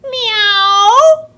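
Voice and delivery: high, loud meow rising at the end; long rising cat call. Acted. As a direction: yowl it like a cat. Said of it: "And he went".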